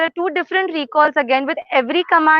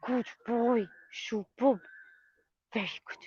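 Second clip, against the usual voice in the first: soft praise; 5 semitones lower, 14 dB quieter than usual. Real, not acted.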